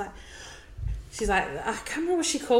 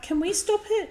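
put-on kid voice; 14 semitones higher than usual. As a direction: beg like a child in the back seat. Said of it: "She's like".

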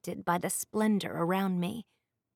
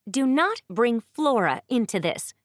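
loud, sharp warning; almost yelling; 4 semitones higher, 6 dB louder than usual. Acted. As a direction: nearly shout it at him. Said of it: "almost yelled at her brother".

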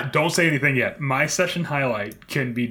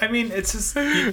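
usual speaking voice

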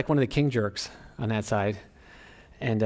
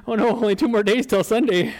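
high comic panic; mock dread; 10 semitones higher, 5 dB louder than usual. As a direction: exaggerate the worry for laughs. Acting out dread of Sunday.

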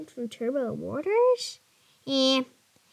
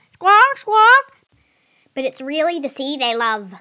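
high, loud squawky parrot voice; cheeky parrot; 6 semitones higher, 7 dB louder than usual. Acted. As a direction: tell jokes as a cheeky parrot. Parrot Squawks tells jokes.

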